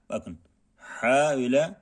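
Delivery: normal talking voice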